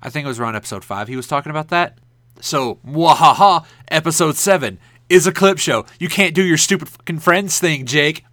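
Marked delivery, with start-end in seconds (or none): 2.4-8.2 sneering panto villain. Pretend. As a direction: gloat like a pantomime baddie. Gloating at Jake over clip show.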